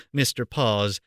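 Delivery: ordinary delivery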